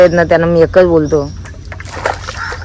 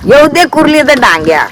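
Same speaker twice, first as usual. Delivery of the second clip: imitating dad's catchphrase; 11 semitones higher than usual. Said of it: "पप्पा काय बोलतो माहितीये का".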